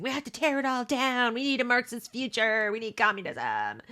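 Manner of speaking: high, mocking rioter chant; mock protester. Host mocking rioting kids, high mock chant. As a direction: ape the zealous protesters.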